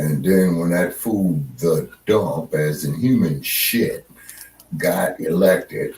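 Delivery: usual speaking voice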